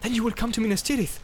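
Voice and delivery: high, quick, eager question; eager cry. A narrator's reading. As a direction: ask it with rising hope, pitched high. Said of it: "cried Boromir".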